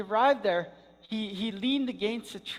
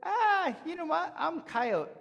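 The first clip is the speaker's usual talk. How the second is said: higher, breezy dismissal; shrugging it off; 9 semitones higher than usual. Acted. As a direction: brush it aside proudly. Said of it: "But he said".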